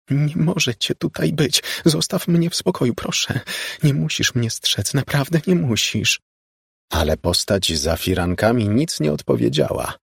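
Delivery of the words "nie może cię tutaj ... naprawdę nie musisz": frightened pleading. Acted. Laura pleads with ghost.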